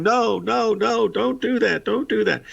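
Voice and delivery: high-pitched mock yell of a frantic friend; retold yelling. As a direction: cry out no, no in a panic, half acted. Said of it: "Eddie was cussing and screaming and yelling".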